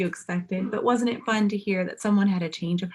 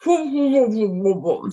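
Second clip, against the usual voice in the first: louder mumble through a mouthful; mouth full; 4 semitones higher, 6 dB louder than usual. A narrator's reading. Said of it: "said the man".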